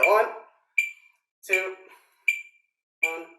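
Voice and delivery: crisp count-in, each number spaced. Real, not acted. Drum teacher counting the class in to start, crisp count-off. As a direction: call the count-in sharply to the band.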